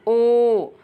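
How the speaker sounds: loud, long-held "ओ"; teacher's forceful, stretched model of "ओ"; drawn-out syllable. Shown as a demonstration. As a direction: push out "ओ" and sustain the vowel. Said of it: "जो लंबा खींचता है ना".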